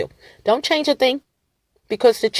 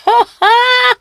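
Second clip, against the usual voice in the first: mocking laugh; 5 dB louder than usual. Real, not acted. Mocking laugh at computer companies.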